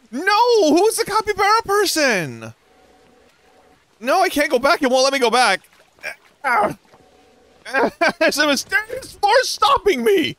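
high shocked cry; can't believe it, squealing. Real, not acted. Shocked at teammate's death.

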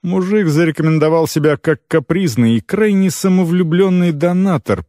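ordinary delivery

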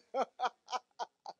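real, unrestrained laugh. Real, not acted. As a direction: burst of laughter. Laughing after the climax.

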